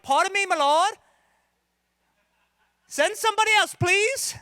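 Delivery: very high, loud hammed-up British accent. Preacher shows how he reads Moses's plea, mock British accent. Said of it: "But that's how I read it".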